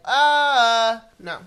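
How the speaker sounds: loud, disgusted mom refusal; hammed-up mom saying no; disgusted mother. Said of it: "I feel like my mother".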